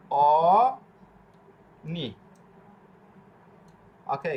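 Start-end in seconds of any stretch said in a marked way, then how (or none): 0.1-2.1 অনি stretched very slowly; drawn-out model pronunciation. Shown as a demonstration. Teacher sounding out the word for learners, অনি drawn out slowly with no g. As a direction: hold the first vowel of অনি long, then a separate final syllable. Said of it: "এটা হবে কি".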